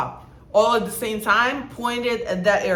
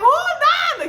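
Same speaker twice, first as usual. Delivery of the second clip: yelling hold on; 6 dB louder than usual. Retold or imitated. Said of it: "I keep yelling".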